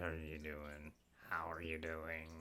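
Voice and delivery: low rough South Park voice; gravelly voice. Shown as a demonstration. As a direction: push it down into gravel. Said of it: "shape my voice like this".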